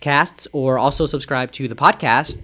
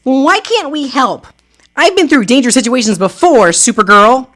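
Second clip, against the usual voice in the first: high, loud heroic Supergirl voice; a superheroine calling out in alarm; high heroine voice; 12 semitones higher, 9 dB louder than usual. Acted.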